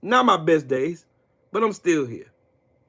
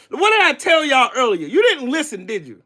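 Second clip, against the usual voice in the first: ordinary talk, nothing marked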